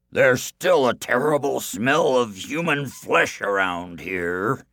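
loud, drawn-out suspicion; suspicious giant. A narrator's reading. Giant smells a human at home, loudly suspicious. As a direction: boom it slowly as a suspicious giant. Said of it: "he said suspiciously".

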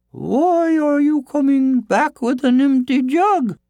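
very high, loud, slow woman's voice; high, stretched-out curious question; questioning village woman. Acted. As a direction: ask it as a high-pitched storybook woman. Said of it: "asked the woman".

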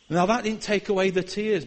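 ordinary talk, nothing marked

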